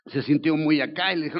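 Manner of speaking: ordinary delivery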